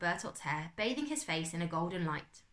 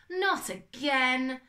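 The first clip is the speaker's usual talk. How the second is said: drawn-out, higher, louder wail; 8 semitones higher, 6 dB louder, much slower than usual. Acted. Bertolt wailing about a bug in his hair, slow wail. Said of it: "Bertolt wailed".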